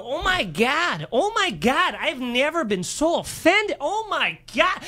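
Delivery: high, very slow imitation of outraged women; mock offended Minnesota women. Imitating women kicked out of the show, offended. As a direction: drag out the scandalized Minnesota whine. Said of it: "these are Minnesota women".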